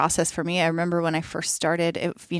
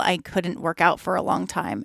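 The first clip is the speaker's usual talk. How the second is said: ordinary talk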